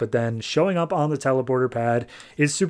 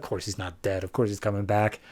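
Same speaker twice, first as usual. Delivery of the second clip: usual speaking voice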